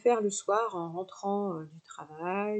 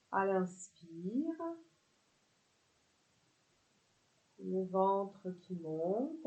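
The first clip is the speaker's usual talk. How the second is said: calm body-scan voice with long pauses; softer, unhurried guidance into rest; guided relaxation; 4 dB quieter than usual. Acted. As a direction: let each phrase settle before the next.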